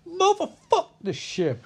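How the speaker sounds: very high, drawn-out comic holler; hammed-up mock outburst. [name] topping the crew's curses, high hammed-up holler. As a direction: stretch "Motherfuck" into a high mock cry. Third crewmate tops the others' curse.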